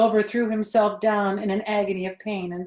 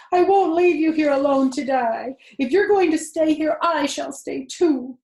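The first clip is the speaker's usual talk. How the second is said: higher, louder, desperate protest; shouting; 7 semitones higher, 8 dB louder than usual. A narrator's reading. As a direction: refuse to leave her, shouting. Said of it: "shouted Wilbur".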